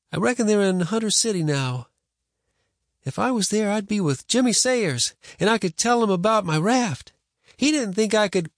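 put-on high-pitched, wistful boy voice; wishful boy. Boy wishing he were in Hunter City, wistful. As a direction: daydream aloud as a child.

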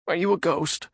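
high nervous man voice; frightened Radagon. Acted. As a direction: ask in timid fear.

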